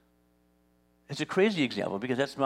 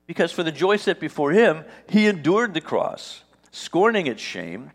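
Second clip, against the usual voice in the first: louder, building scripture proclamation; scripture reading; 3 semitones higher, 7 dB louder than usual. Acted. Preacher reading Hebrews to the congregation, building proclamation. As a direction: proclaim the verse with mounting force. Reads scripture with rising force.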